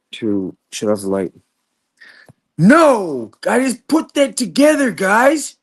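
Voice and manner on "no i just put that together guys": loud, high frustrated yell. Real, not acted. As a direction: shout it out in frustration. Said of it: "I'm sorry I'm yelling a lot".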